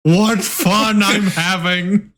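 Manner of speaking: drawn-out fake delight; sarcastic. Covered in goo, deadpan joke.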